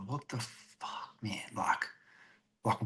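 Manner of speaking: under-the-breath whispered curse; hushed whisper. Retold or imitated. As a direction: mutter it so nobody hears. Retells muttering at shoppers' trolleys.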